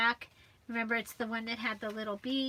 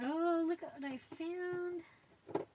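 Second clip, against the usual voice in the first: delighted surprise; 6 semitones higher, slower than usual. Real, not acted. Delighted discovery.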